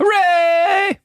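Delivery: high, loud, long hooray; cheer. Real, not acted. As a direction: shout it out like a celebration. Cheering that the attack hits.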